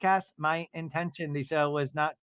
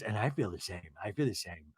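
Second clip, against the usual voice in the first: soft, much lower imitated voice; low, subdued interjection; 9 semitones lower, 9 dB quieter than usual. Recapper as Meredith agreeing she feels the same, low and quiet. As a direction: drop right down and state it quietly.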